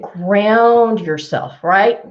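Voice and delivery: slow grounding. Shown as a demonstration. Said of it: "guiding listener to breathe".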